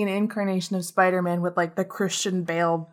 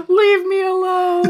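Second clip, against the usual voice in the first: high, drawn-out mock wail; mock wailing yell; 9 semitones higher, 4 dB louder, slower than usual. Villain yelling back to be left alone, hammed-up wail. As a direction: stretch it into a hammed-up cry. Joke of yelled reply, played out.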